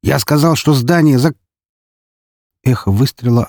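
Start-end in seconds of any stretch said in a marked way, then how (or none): 0.0-1.4 barking shout. Acted. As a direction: bark the warning again. Guard repeats threat, cut off.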